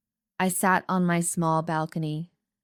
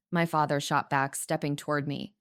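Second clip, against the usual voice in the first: ordinary delivery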